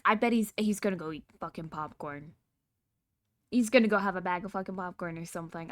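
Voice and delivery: normal talking voice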